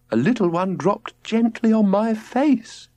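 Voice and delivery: lightly voiced tender wife; dreamy mother. A narrator's reading. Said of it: "murmured Albania".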